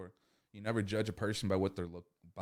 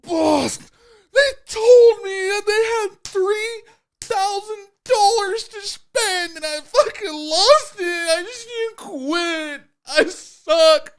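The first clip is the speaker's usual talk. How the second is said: much higher, louder, slow hammed-up crying to the boss; mock sobbing wail; 18 dB louder, much slower than usual. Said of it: "'cause you're like".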